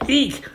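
very high shriek; startled "eek" squeal; frightened shout. A narrator's reading. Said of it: "Marty shouted".

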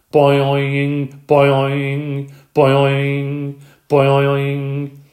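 loud, slow, springy twangs; frog boing. A narrator's reading. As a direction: stretch each twang out. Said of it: "Og started up again".

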